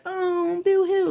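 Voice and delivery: high, whiny fake cry; mock a tiny pretend cry, high; mock whimper. Mother mocking a small cry, high and whiny. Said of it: "Not just like".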